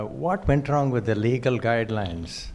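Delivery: ordinary delivery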